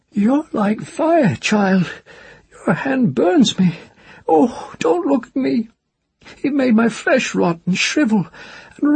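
a cursed hag crying to die; weeping old witch. Acted. Cursed witch weeps to the child, begging to die.

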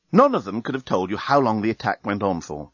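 ordinary delivery

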